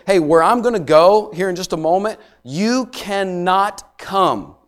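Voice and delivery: you cannot come stretched out very slowly; gentle but firm voice of Jesus, very slow; slow emphatic warning. Acted. Preacher voicing Jesus.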